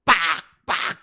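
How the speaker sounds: short, sharp "ppak" whack; imitated striking sound. Preacher imitates Moses hitting the rock, sharp whacks. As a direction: voice two separate hard blows. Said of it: "반석을 때려".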